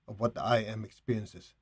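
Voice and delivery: ordinary talk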